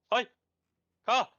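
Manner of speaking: shouted callout. Real, not acted. Warning teammates about a car.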